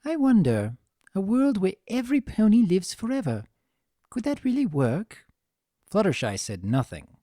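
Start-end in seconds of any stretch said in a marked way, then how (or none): 0.0-5.3 lightly voiced refined mare, wondering aloud; Rarity musing. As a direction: muse aloud as a refined lady.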